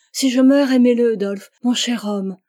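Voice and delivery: loud, pained plea to her husband; anguished cry. A narrator's reading. Wife in labour begging her husband, anguished loud cry. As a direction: cry it out as if she might die.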